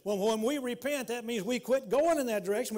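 ordinary talk, nothing marked

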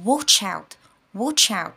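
"Watch" hit loud, "out" light; warning, stress on start. Shown as a demonstration. Teacher warning a student, stress on "Watch". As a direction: punch "Watch", let "out" fall away. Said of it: "هعمل ستريس على بداية كلامي".

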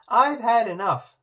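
a fed-up princess snapping at the frog, loud and high; angry snap. A narrator's reading. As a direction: snap in fury. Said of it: "snapped Poppy".

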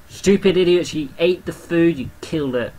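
ordinary talk, nothing marked

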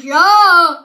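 loud strained yell. Real, not acted. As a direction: yell it out through the sour. Enduring sour candy.

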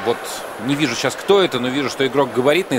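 usual speaking voice

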